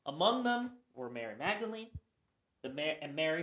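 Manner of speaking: ordinary talk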